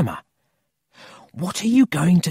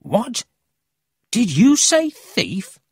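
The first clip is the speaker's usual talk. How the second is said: high, loud, drawn-out amazement; astonished; 11 semitones higher, 6 dB louder, slower than usual. Acted. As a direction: react with startled excitement. Boy amazed to hear of a thief.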